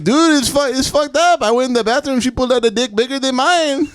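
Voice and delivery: high, put-on shocked coworker; hammed-up startled installer voice; imitating Carlos. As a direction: tell it like a spooked coworker. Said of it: "he's like, he's like".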